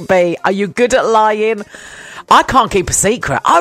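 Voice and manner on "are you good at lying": normal talking voice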